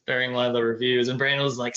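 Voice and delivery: ordinary talk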